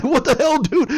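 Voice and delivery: high, indignant victim voice; high mock protest; mock outraged victim. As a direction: squeal it like the beaten victim.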